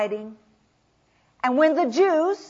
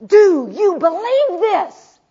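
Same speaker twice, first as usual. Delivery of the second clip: loud, slow, higher question; searching question; 5 semitones higher, 7 dB louder, slower than usual. Acted. Jesus asking Martha, loud and slow. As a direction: ask it slowly, pressing her. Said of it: "he takes her and he says".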